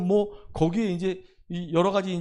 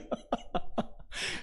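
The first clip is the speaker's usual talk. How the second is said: short real laugh; amused laugh; 9 dB quieter than usual. Real, not acted. Pastor joking about paying and still praying, short laugh. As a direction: chuckle at your own remark. Amused by his own remark.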